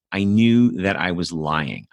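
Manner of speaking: usual speaking voice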